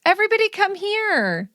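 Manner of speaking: mock sugary invitation to everyone's kids; eager baby lover. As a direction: coo it like someone desperate to babysit. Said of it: "I'm not the person that's like".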